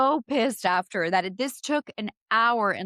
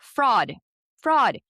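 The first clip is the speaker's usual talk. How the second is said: loud repeated shout of fraud; yelling fraud; 3 semitones higher, 6 dB louder than usual. Retold or imitated. Yelling fraud at the automated phone line. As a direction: yell it so the phone menu hears. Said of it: "I'm, like, yelling".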